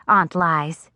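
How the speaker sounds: plain reading voice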